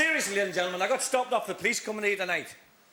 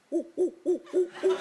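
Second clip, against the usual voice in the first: high hooting ape calls; monkey noises; 8 semitones higher than usual. Acted. Comic playing the monkey, high hoots. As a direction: hoot like a chimp. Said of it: "And the monkey went".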